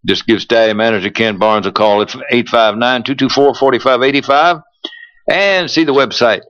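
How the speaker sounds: radio ad announcer read; polished sponsor spot delivery. Acted. Reads a stallion ad.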